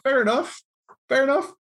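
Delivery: high, light, easygoing fair enough; breezy acceptance. Retold or imitated. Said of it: "I'm like".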